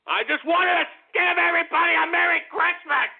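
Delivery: hammed-up self-pity; whiny complaint. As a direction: wail it like a hurt child.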